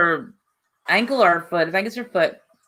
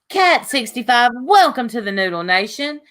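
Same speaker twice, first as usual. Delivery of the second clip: ordinary talk, nothing marked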